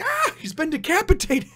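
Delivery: very high, loud falsetto scream; hammed-up girl screaming in horror; mock horrified shriek. Acts girl's horror at the head.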